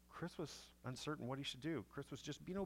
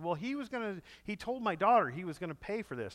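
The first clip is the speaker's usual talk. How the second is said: higher and louder, imitating the indignant father; retold loud protest; 8 semitones higher, 11 dB louder than usual. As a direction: play it like a father loudly complaining.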